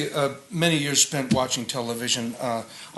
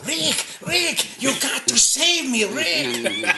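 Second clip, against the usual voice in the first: much higher, pleading voice of a man being chased; Peter Lorre impression; 12 semitones higher, much slower than usual. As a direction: beg Rick for help in a high, frantic voice. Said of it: "old Peter Lorre's".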